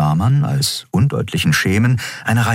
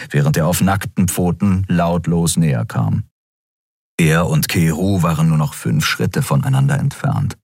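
usual speaking voice

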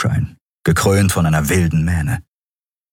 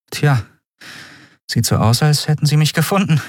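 higher-pitched, not quite unimpressed; feigned nonchalance; 9 semitones higher than usual. A narrator's reading. Prisoner answers the stranger who found her, feigned nonchalance. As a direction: play it cool and don't quite manage it. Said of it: "nicht ganz so unbeeindruckt an".

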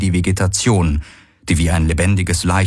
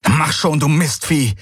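angry urging; 7 semitones higher than usual. Acted. Galen curses the stubborn animal.

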